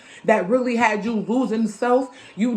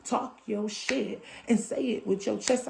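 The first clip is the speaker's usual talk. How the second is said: much softer, measured repetition; hushed mantra; 10 dB quieter than usual. Real, not acted. Repeating the mantra to listeners, hushed but deliberate.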